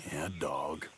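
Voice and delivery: hushed whisper while hiding. Acted. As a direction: breathe the words out, no voice. Hiding from the cougar.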